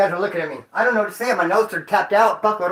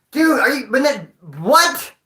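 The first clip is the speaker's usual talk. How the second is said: ordinary talk, nothing marked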